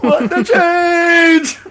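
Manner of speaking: very high, drawn-out mock wail; hammed-up squealed disbelief, loud and long; mock outburst. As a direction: wail it slowly in a silly falsetto. Hammed-up outburst at odd question.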